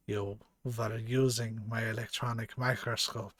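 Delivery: bad German accent for a stern scientist; lightly put-on German accent, questioning sharply; attempted German accent.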